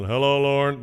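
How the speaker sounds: lightly voiced dad calling out his own name; father's morning greeting. Father's daily signal the day began, mild imitated hello. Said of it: "the signal that the day had begun".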